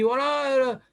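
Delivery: a hint of a guy swearing over a football score; teacher imitating a student who swears on trivia; mock football fan. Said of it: "Le mec, il dit".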